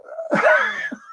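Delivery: high laugh. Real, not acted. Laughs at the story.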